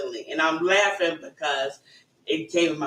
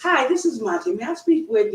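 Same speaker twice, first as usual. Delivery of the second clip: higher-pitched professional phone greeting; 6 semitones higher than usual. Acted. Call center agent greeting a customer, professional. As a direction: put on a call-center hello. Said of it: "have this professional".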